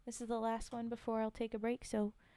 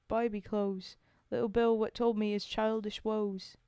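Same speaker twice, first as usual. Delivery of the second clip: put-on Cockney storytelling voice; homely Cockney verse monologue; Cockney accent; 7 dB louder than usual. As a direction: recite it like an amateur Cockney. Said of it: "Cockney British one".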